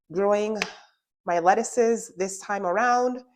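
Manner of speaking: ordinary talk, nothing marked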